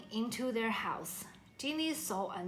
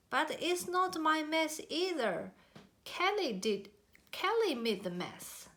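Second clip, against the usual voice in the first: mild arguing; 7 semitones higher than usual. A narrator's reading. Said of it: "They began to argue".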